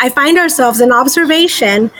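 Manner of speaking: ordinary delivery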